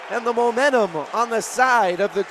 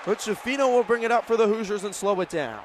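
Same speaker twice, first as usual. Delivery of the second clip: normal talking voice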